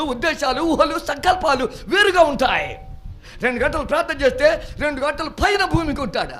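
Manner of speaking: loud, high, fervent preaching. Acted. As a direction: preach it out to the congregation. Preacher at full force. (గట్టిగా ఉత్సాహంగా ప్రసంగం)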